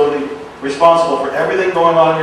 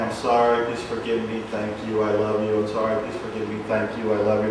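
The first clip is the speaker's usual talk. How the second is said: soft, low murmur of the mantra; quiet mantra; 5 semitones lower, 7 dB quieter than usual. Retold or imitated. Recalling saying the mantra to the divine in his hot tub, soft and relaxed. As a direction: murmur it softly as if lying in a hot tub under the stars.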